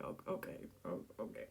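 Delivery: soft whispered fluster. Acted. He answers her quietly in a whisper. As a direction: whisper it, caught off guard.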